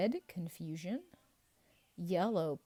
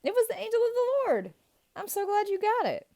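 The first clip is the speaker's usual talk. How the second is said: much higher and louder, fast and thrilled; excited high reveal; 11 dB louder, faster than usual. Acted. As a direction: burst out with the right answer in a high, cheering voice. Excited reveal of right answer.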